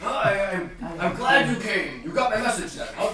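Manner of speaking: high, loud, excited host character; stammering, pleased tinkerer; eccentric host. Acted. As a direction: greet guests in a flustered, delighted rush. Game master plays Quinn greeting guests.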